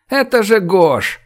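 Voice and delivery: high, stretched cry of recognition; excited high-pitched holler; shout upward. A narrator's reading. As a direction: call the news up to those above. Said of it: "крикнул он наверх".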